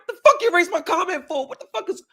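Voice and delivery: high, loud angry outburst; mad. Retold or imitated. After his comment was erased, a high angry outburst. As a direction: demand it furiously. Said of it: "I'm like, mad looking around".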